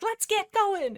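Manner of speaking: high-pitched eager urging; peppy rallying. Acted. Freckle Dapple hurrying her reluctant clanmates along, peppy. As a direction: chirp it like an over-keen coach. Peppy cat rallies the clan.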